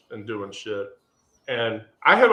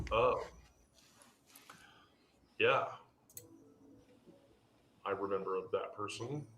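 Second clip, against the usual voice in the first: halting, softer and lower; taken aback; 4 semitones lower, 12 dB quieter than usual. Retold or imitated. Answering about a remembered coworker, halting and subdued. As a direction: let it sink in with long pauses. Retold reaction to hearing old name.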